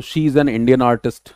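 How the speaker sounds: "an" appuyé dans "she is an Indian artist"; stress on an. Shown as a demonstration. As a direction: lean on "an" before Indian. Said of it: "Indian में जो sound है हमारा वो vowel sound है".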